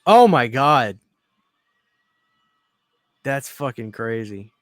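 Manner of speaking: normal talking voice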